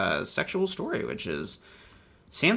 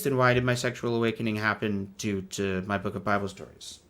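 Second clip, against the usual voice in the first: usual speaking voice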